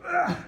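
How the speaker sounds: high effortful grunt; straining grunt. Acted. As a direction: grunt like you're fighting to roll. Struggling subject in demo.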